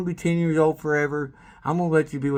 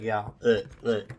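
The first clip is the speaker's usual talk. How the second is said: ordinary talk, nothing marked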